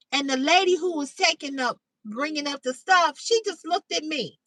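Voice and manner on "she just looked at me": ordinary talk, nothing marked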